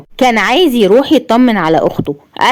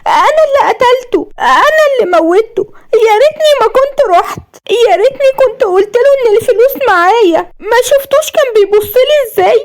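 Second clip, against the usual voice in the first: much higher, wailing through tears; sobbing. A narrator's reading. Grieving wife blames herself for her father's death, sobbing. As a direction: cry out the guilt, sobbing. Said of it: "قالت بنحيب".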